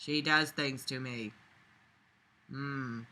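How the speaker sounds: ordinary talk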